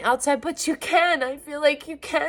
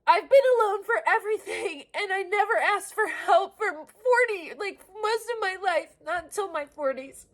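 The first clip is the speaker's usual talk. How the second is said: upset rushed rant; 8 semitones higher than usual. Real, not acted. Continues distraught tirade.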